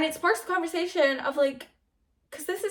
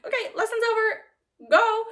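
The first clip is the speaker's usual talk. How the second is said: high, louder dismissive order; acted dismissal; 8 semitones higher, 5 dB louder than usual. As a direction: shoo them out, ending loud on "Go". Acts out curt dismissal.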